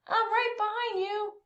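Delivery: high, eager little voice; calling out. A narrator's reading. Beau chasing the armadillo, high eager call. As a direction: call ahead, sure you'll catch up. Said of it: "Beau called".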